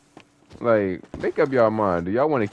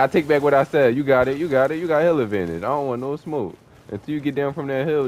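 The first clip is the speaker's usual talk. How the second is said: normal talking voice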